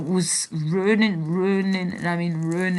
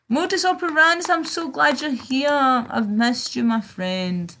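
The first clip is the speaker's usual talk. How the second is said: excited greeting; 6 semitones higher than usual. Real, not acted. Greets a chat friend excitedly.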